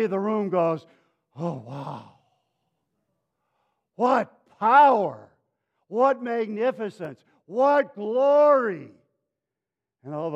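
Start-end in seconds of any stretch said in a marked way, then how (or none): 1.3-2.2 low, soft wow of amazement; awestruck, barely voiced reaction; hushed awe. Everybody in the theater awestruck. 4.0-8.9 high, loud, drawn-out marvelling; grand, slow cry of amazement; awestruck exclamation. Retold or imitated. Crowd marvels at the launch.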